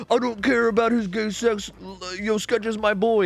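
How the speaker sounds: high, loud mock Ludwig voice; imitating Ludwig. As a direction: ham up a streamer shrugging it off. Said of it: "Ludwig was like".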